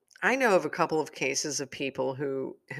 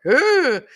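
a loud, high groan of frustration; exasperated ugh; 12 semitones higher, 13 dB louder than usual. Retold or imitated. Fed up with money troubles, a loud high ugh. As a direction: let out a big fed-up ugh. Frustration over bank account.

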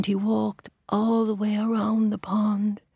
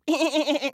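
squeaky giggle; high frog laugh; 9 semitones higher than usual. Shown as a demonstration. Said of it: "laughing and ribbiting at the same time".

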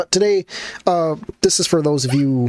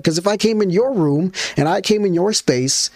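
ordinary talk, nothing marked

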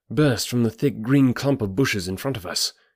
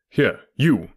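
mildly raised calling voice; shouted summons. A narrator's reading. Detective hailing the frightened groom, mild shout. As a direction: hail him sharply. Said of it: "he shouted".

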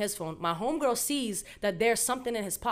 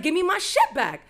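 irritated demand; 7 semitones higher, 4 dB louder than usual. Retold or imitated. Acted demand for the phone.

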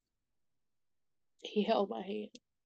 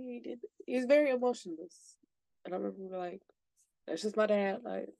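ordinary delivery

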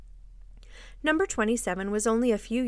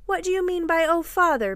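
high, louder indignant demand; raised-voice affronted question; 8 semitones higher, 5 dB louder than usual. A narrator's reading. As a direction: demand an explanation, pitch jumping up. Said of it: "Mister Darling demanded".

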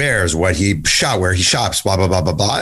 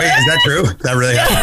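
laughing disbelief; 10 semitones higher than usual. Real, not acted. Cohost hearing the wedding story, laughing in shock.

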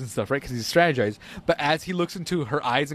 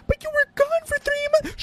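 very high mock-whiny girl voice; mocking Iris whining to Barry, very high. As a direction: squeal it like a pouty wife.

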